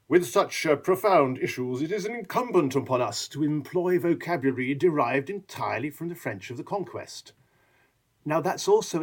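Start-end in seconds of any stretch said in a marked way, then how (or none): 0.1-7.3 lofty, higher, mock-posh register; higher mock-grand formal voice; Frenchified pompous speech. Shown as a demonstration. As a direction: puff it up like an official. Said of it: "But I can also say to you".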